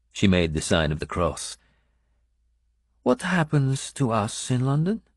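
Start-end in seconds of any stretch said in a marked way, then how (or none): 3.0-5.0 a hint of a higher foreign woman's voice, slowing; lightly voiced foreign woman. A narrator's reading. Benedetta telling the narrator about the king, lightly accented.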